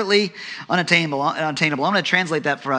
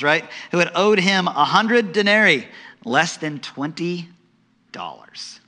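ordinary delivery

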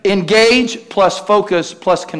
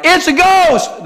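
high, drawn-out terrified cry; cry of fear; 13 semitones higher, 4 dB louder, slower than usual. Acted. Disciples seeing a figure on the lake, crying out in fear. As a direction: cry out in mock terror, stretching it. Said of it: "cried out in fear".

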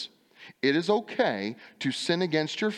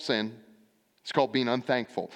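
normal talking voice

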